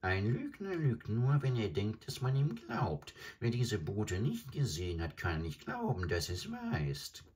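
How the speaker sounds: high croaking bird voice, insisting; a stork stubbornly defending his tale; high croaking bird, insistent; insisting adjutant bird. Acted.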